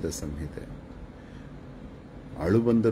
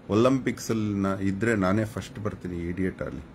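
usual speaking voice

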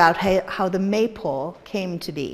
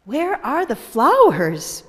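put-on slow, louder, higher girl's plea; Vivian asking; 5 semitones higher, 6 dB louder than usual. A girl asks the townsfolk where the flowers are, slow and worried. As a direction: ask it slowly, worried and raised.

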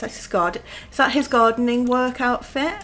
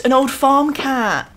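put-on higher, eager old farm cat; excited repeat; 4 semitones higher, 7 dB louder than usual. Shown as a demonstration. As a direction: say it again with glee.

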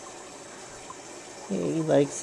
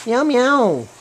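loud, high meows falling away; mock the cat with loud meows; high human meow, swooping down; playful cat imitation; 7 semitones higher, 8 dB louder than usual. Meowing back at the cats.

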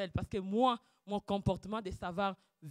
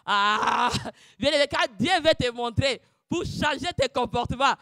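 louder preaching; fervent sermon close; preaching rising; 5 semitones higher, 12 dB louder than usual. Acted. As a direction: build to the point. Preaching outburst.

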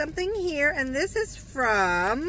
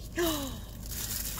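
gasp; 10 dB quieter than usual. Real, not acted.

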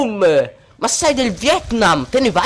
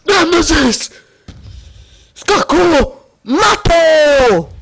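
higher, louder gloating; triumphant shout; 5 semitones higher, 5 dB louder, much slower than usual. Real, not acted. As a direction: call it out like a victory. Triumphant gamer exclamation. (urlo di trionfo)

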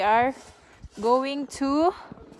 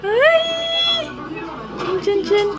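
surprised shout; 3 dB louder, much slower than usual. Real, not acted. Sudden exclamation at food.

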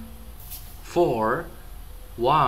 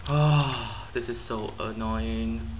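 usual speaking voice

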